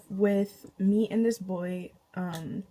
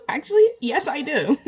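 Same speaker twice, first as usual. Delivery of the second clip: sassy; 5 semitones higher, 7 dB louder than usual. Retold or imitated. Mock-sassy confirmation.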